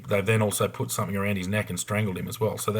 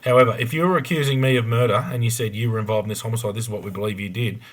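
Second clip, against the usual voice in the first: ordinary talk, nothing marked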